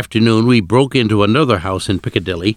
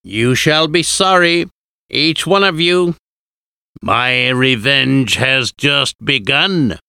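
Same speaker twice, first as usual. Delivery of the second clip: high, slow vampire threat called upward; Count Dracula calling up; 4 semitones higher, slower than usual. A narrator's reading. Dracula calls up from below the window to the hunters, high slow threat. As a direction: call up a vow of revenge, slow and high. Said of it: "and called up to us".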